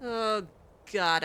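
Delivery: ordinary talk, nothing marked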